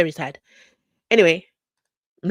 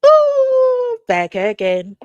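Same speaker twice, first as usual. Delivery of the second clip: excited; 9 semitones higher, 10 dB louder, much slower than usual. Real, not acted. Stream returns.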